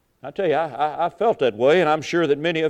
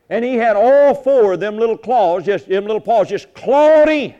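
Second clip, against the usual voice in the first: preaching at full force; 4 semitones higher, 7 dB louder than usual. Acted. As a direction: drive the image home to the congregation.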